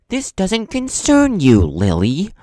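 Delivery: loud, slow cutting dismissal; cold dismissal. Retold or imitated. Gordon Ramsay silencing Lily, loud slow dismissal. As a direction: shut her down, slow and loud. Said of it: "I'll never forget his reply".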